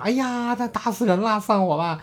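high, hammed-up wail; imitating Bajie clamoring high to the master; mock clamoring. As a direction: clamour it like a fussy shrew. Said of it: "所以他这个嚷嚷".

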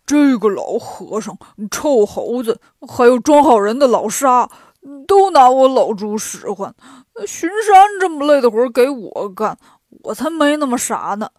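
loud, griping pig character; grumbling complaint. A narrator's reading. Pigsie cursing his master and companions behind their backs, complaining. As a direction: grumble loudly about everyone who sent you. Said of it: "回头指着来时的方向埋怨".

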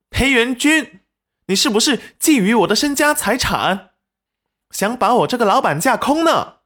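high-pitched indignant outburst; angry accusation. A narrator's reading. Wife accuses husband of eyeing her fortune, angrily. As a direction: accuse loudly, voice pitched right up. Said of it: "戚云染立即怒道".

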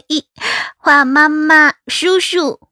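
put-on giggle, then announce it like a proud kid; giggling little child. A narrator's reading. Little daughter showing mother her drawing, giggly. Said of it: "暖暖抬头对我咯咯笑".

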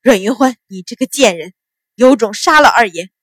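angry shouted insult building to a yell; groaning curse. Acted. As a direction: curse loudly, hurling out the final dare. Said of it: "骂道".

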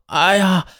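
urgent, high-pitched begging; desperate put-on plea; pleading cry. A narrator's reading. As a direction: beg as if you cannot hold it any longer. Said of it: "大声地对张丽红央求道".

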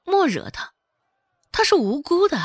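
high, protesting inner voice; inner cry. A narrator's reading. Heroine protests inwardly for her own sake, high-pitched cry. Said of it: "周芷心里呐喊道".

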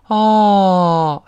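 long, falling "now I get it" ah; ah of realisation. Shown as a demonstration. Teacher mimics sudden realisation, long dawning ah. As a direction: let out a drawn ah of sudden understanding. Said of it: "大恍然大悟".

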